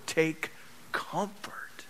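very slow, whispered comfort; hushed exhortation. Real, not acted. Preacher urging congregation after promised return, hushed exhortation. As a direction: breathe it out slowly and softly.

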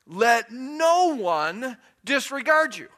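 loud, high, slow and emphatic; commanding declaration. Acted. Preacher delivering the passage's next phrase, commanding declaration. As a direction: proclaim it as an order.

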